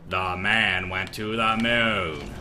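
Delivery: robotic voice. Shown as a demonstration. Demonstrating a schoolboy's joke reading voice, robotic. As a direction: read it out slowly like a robot. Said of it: "robotic voices".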